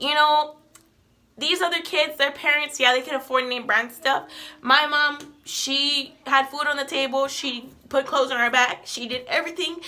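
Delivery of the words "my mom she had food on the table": ordinary delivery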